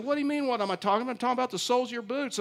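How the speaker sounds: fast, high, huffy comeback; mock irritated agent. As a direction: rattle it off like an annoyed guard. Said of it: "He said".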